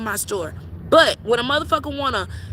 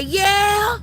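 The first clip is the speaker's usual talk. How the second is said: mock shouted reply; 7 semitones higher than usual. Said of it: "your ass better say".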